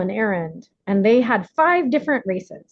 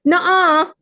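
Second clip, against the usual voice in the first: put-on high, loud childish refusal; inner child; 9 semitones higher, 5 dB louder than usual. Her inner child refusing the affirmation, high childish nuh-uh. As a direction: refuse like a stubborn little kid. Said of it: "my inner child voice would go".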